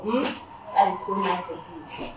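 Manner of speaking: usual speaking voice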